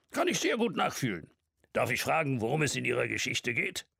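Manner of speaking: put-on high, lively old man teasing; jovial old man. A narrator's reading. Old man teasing Mark about his story, jovial and high. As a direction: warmly needle him.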